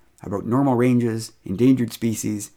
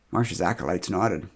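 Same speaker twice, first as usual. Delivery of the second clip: usual speaking voice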